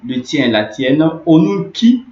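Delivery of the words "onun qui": Onun qui called out high and loud, weight on qui; teacher calls out Onun qui loudly to warn of the exception; emphasis. Shown as a demonstration. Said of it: "il n'y a pas d'harmonie de voyelles".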